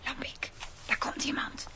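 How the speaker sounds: hushed whisper to a partner; tense stakeout whisper. Acted. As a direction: whisper so the crook won't hear. Whispering while lying in wait.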